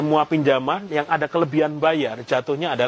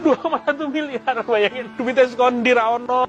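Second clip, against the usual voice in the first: much higher, laughing incredulity; laughing disbelief; 8 semitones higher than usual. Real, not acted. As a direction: repeat it pitched right up through laughter. (tertawa tak percaya, nada tinggi)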